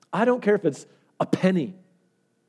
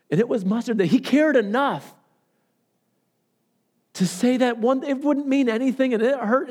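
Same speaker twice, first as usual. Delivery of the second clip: retold emotional outpouring, pitched high; imitating overwhelmed woman; 8 semitones higher, 3 dB louder than usual. Woman telling the pastor that God cared enough, overwhelmed. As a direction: push her testimony out high and insistent. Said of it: "she could hardly talk as she was saying it".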